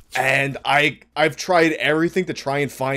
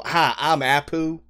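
put-on slow, plain American-accented greeting; Apu with American accent; 2 semitones higher, slower than usual. Apu suddenly given an American voice, slow American accent. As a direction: say it like a bland all-American guy. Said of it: "have an American voice".

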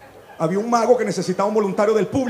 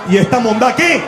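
shocked yell; 4 semitones higher, 6 dB louder than usual. Retold or imitated. Boyfriend finds him, voiced.

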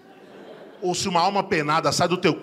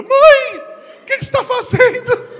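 put-on very high, loud shocked girl voice; shocked teen daughter; 13 dB louder, slower than usual. Daughter catching her mother, shocked and high. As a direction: squeal it like a stunned teenage girl.

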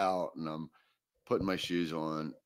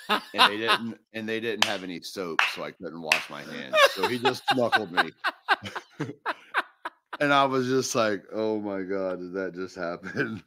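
real laughter breaking through, higher and louder; laughing while telling; 4 semitones higher, 7 dB louder than usual. Real, not acted. Cracking up telling co-host the Klay Thompson story, laughing.

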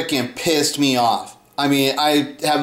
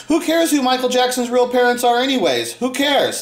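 higher, sneering imitation of "Who cares"; mocking imitation; 7 semitones higher than usual. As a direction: mimic the fans' dismissive "Who cares". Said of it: "When they say that line".

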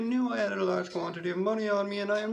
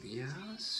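whispered aside; 6 semitones lower, 9 dB quieter than usual. Acted. Aside check with the audience.

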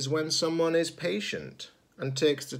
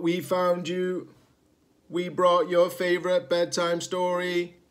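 louder, fussing robot voices; old robots, relieved and doting, louder; worried old robots; 5 semitones higher, 5 dB louder than usual. Acted. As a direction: fuss over him like anxious grandparents. Old rusty robots greet Zed.

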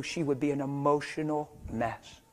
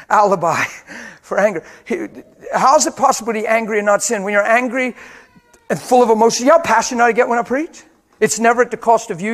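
loud, passionate pulpit preaching with a higher pitch; full-force preaching; 5 semitones higher, 11 dB louder than usual. Acted. Pastor urging the congregation not to sin in anger, preaching loudly.

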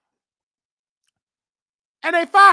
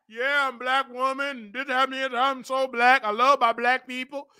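mocking Candace; 5 semitones lower, 4 dB quieter than usual.

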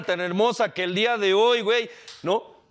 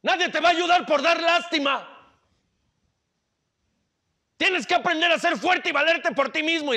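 stern mother; 10 semitones higher than usual. Acted. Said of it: "la señora empieza a llorar y le dice".

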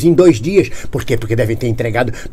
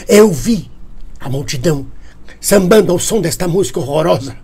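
ordinary talk

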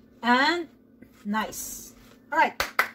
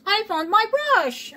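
excited; 7 semitones higher, 3 dB louder, slower than usual. Real, not acted. Excited over found brush.

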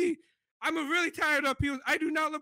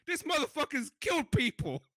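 ordinary talk, nothing marked

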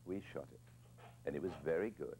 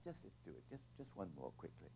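much softer, a quiet hurried plea; hushed coaxing; 12 dB quieter than usual. Retold or imitated. Director quietly coaxing the upset actress, softly.

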